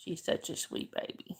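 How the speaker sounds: hushed coo to the cat. Real, not acted. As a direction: whisper sweetly to a pet. Cooing to her pet.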